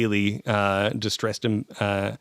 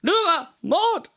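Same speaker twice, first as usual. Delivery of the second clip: put-on pompous drunk lord. Lord Damo announcing his grand exit, high and pompous. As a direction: play the puffed-up drunk nobleman.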